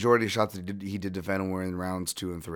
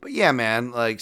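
normal talking voice

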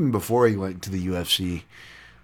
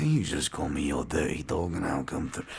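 creepy Davey Grant impression; 4 semitones lower, 6 dB quieter than usual. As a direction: purr it like a creep. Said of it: "creepy accent there".

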